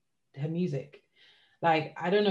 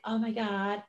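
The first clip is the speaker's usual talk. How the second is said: lightly mimicked, higher-pitched singer voice; a hint of her put-on way of talking; imitating the singer's talk; 5 semitones higher, slower than usual. Podcaster mimicking how the pop singer talks, light higher-pitched imitation. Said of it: "she talks like".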